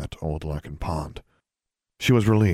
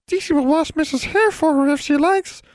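very high, louder squeaky voice; eager servant creature Deasy; 5 dB louder than usual. A narrator's reading. Servant creature speaks in story.

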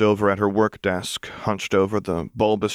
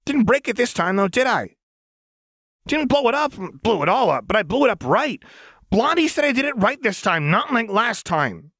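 much higher, manic and defiant; 14 semitones higher than usual. Acted. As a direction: argue with voices only she hears. Jinx arguing with imagined voices.

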